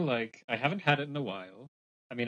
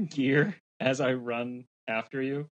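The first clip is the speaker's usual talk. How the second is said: ordinary talk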